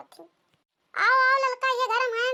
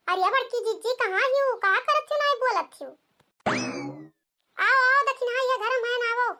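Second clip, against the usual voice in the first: calling out; 5 semitones higher than usual. Acted. Calling the sister, inviting Dakhina in.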